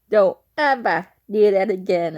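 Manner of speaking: loud mock scolding, stressed on EVER; scolding retold cousin. Cousin reacting to a spoonful of butter, mock scolding. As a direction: imitate an appalled cousin telling you off.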